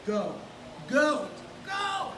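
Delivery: ordinary delivery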